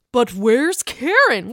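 slowly wondering where she is; drawn-out question. Acted. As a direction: stretch out WHERE'S KAREN as a question. Mock search, first take.

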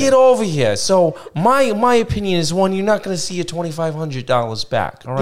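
high, insistent mock mobster accent; Ben Soprano. Host warning caller about her money, in a mobster accent. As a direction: lay down the verdict like a New Jersey wise guy. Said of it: "as Ben Soprano".

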